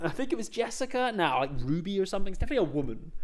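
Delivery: fast quiet self-correction; quick quiet aside. Real, not acted. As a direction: rattle it off quietly, doubting yourself. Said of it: "I'm gonna check that in post".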